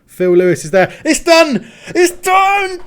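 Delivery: real triumphant yelling, laughing; ecstatic shouting. Real, not acted. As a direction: yell it out, the match is won. Winning goal scored, celebrating.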